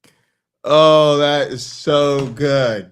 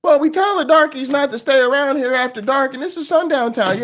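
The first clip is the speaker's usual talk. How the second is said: high, fast mocking sheriff voice; 11 semitones higher, faster than usual. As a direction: ham up a smug small-town lawman. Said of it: "They'll be like".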